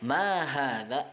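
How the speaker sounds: Ma hadza asked slowly with a rising end; teacher modelling an Arabic question; slow model question. Shown as a demonstration. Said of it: "kita mau nanya, apakah ini?".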